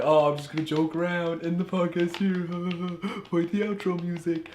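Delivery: lightly mocking imitation of the co-host. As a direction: tease him by playing him, half-laughing. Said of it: "he's like".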